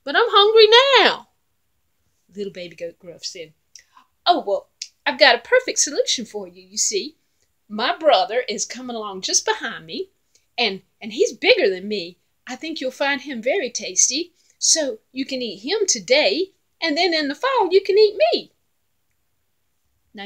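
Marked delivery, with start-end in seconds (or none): 0.0-1.3 high, loud whine; whining troll. Acted. Troll whining to the goat that he is hungry now, high and loud. 4.2-18.5 a touch higher, sly little goat; lightly voiced little goat. Little goat offering his brother to the troll, lightly high and sly.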